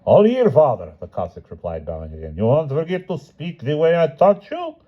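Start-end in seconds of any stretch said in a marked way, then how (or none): 0.1-0.9 higher, louder eager reply; a hint of a chorus of eager Cossacks; Cossacks answering. 2.3-4.8 higher, lightly voiced haughty hetman; a hint of a lordly warning; hetman's reminder. Hetman instructing Cossacks.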